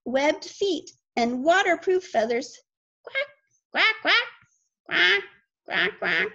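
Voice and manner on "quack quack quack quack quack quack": duck quack. Pretend. Ducks shown to children.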